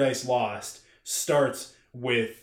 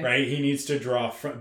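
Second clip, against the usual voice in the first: ordinary talk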